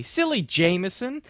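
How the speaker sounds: usual speaking voice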